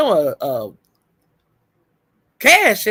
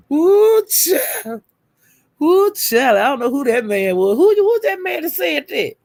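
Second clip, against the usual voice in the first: shrieking delight; 9 semitones higher than usual. Real, not acted. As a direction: squeal it out in disbelief. Laughing shock at cash app note.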